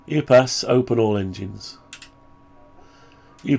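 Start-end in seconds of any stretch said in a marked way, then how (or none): none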